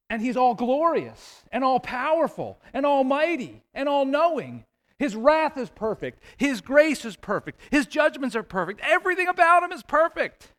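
mounting praise, topping out high and forceful; building preaching fervor. Real, not acted. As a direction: pile up each phrase, climbing to an impassioned finish. Preacher builds list of God's perfections.

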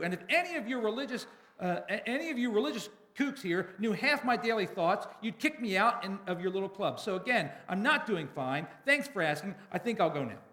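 fed-up outburst losing steam; frustrated yell. A narrator's reading. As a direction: call them out, then wind down. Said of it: "I yell out so everyone can hear".

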